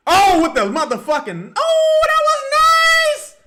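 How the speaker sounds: high, loud, slow roar of joy; ecstatic yell. Real, not acted. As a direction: scream it as the play lands. Celebrating a great play.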